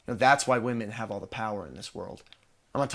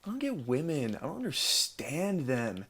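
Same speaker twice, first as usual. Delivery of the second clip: mocked loser; 4 semitones higher than usual. Bragging guy mimicking men baffled by women, mocking loser voice. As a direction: mimic a helpless loser, stretching the words. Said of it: "those losers who sits around and is like".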